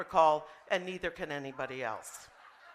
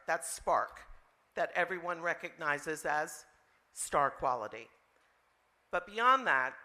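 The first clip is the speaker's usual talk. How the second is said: ordinary talk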